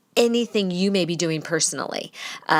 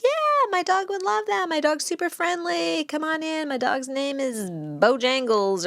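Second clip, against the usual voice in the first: gushing dog owner; 8 semitones higher than usual. Retold or imitated. Eager dog owner giving permission.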